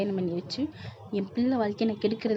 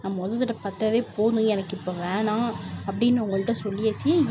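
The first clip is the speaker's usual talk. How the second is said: ordinary delivery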